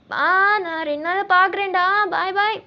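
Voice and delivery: high, loud and drawn out; called-out farewell. Acted. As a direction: call goodbye back as you leave. Farewell called while leaving.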